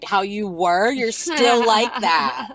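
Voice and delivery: high, slow mocking reply; teasing retort. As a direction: drag it out, teasing. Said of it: "my other cousin was like".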